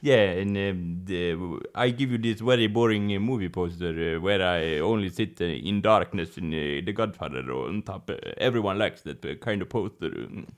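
low, soft, halting boss voice; Godfather figure, self-satisfied. Acted. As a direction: murmur it as the quiet mafia boss. Speaks on as the Godfather.

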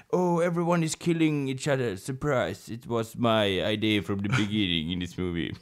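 soft, low-key villain reveal; mafia boss. Retold or imitated. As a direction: play the quietly scheming boss. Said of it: "except the mafia boss, who's like".

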